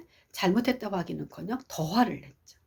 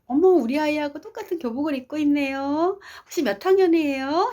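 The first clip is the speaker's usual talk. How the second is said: high, louder, chatty mother's greeting; friendly mother; 8 semitones higher, 4 dB louder than usual. Retold or imitated. Mother striking up talk with a student in the elevator, high and friendly. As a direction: play a mom eagerly approaching a student. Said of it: "다른 학생에게 말을 걸었어요".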